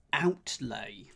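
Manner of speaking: ordinary delivery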